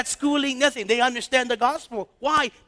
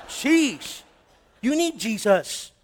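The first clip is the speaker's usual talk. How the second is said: ordinary delivery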